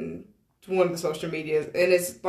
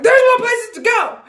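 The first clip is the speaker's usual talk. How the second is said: much higher, louder snap; snapping; 9 dB louder than usual. Retold or imitated. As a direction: lose patience and blurt it out. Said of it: "that's how they snapped in the end".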